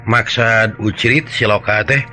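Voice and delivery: usual speaking voice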